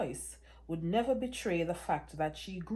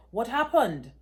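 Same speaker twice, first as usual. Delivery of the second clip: loud, higher cry at Mark; shouting; 4 semitones higher, 9 dB louder than usual. Acted. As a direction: shout the question down the phone. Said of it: "I was shouting at Mark".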